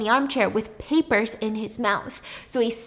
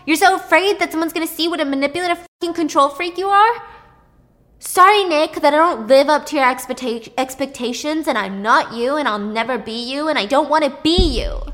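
bitter shouting; 6 semitones higher, 7 dB louder than usual. Retold or imitated. Brother's angry tirade.

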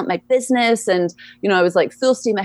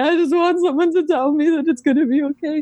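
higher, imitating her own tearful plea; retold tearful plea; 9 semitones higher than usual. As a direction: replay the plea as if close to tears, not full crying. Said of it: "as I'm, like, crying".

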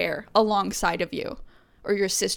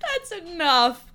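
mock scold. Real, not acted.

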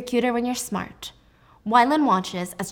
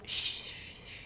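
whispered shushing; 16 dB quieter than usual. Retold or imitated.

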